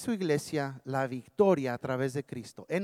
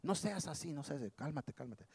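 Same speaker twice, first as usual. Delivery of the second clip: hushing; 2 semitones lower, 7 dB quieter than usual. Acted. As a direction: hush them down, fading nearly to nothing. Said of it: "He wants to keep us quiet".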